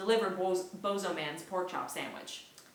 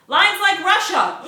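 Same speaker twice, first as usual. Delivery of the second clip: excited exclaim; 11 semitones higher, 15 dB louder, slower than usual. Acted. Yuri explaining panicked crowd.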